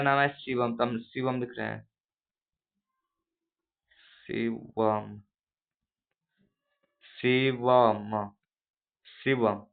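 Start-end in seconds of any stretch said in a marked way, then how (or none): 4.3-5.2 शिवम stretched and soft; drawn-out name. Shown as a demonstration. Teacher stretching own name for class. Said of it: "इसमें ज्यादा समय लग रहा है". 7.2-8.3 शिवम drawn out very slowly; very slow stretch. Shown as a demonstration. Teacher prolonging the name to show length. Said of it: "इसमें ज्यादा समय लग रहा है".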